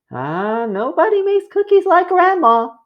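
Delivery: storybook mother, high and sweet; mother's voice. Acted. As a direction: play Ma, fondly praising Grandma. Ma voiced in picture book.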